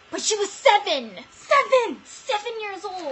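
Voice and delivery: indignant outcry. Real, not acted. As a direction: stress how shocking it is. Indignant about her age.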